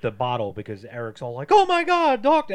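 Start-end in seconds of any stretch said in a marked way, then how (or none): 1.5-2.5 high, loud mock panic for a doctor; panicked yell. Eric crying out for a doctor, high loud panic. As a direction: shriek it like a frantic husband.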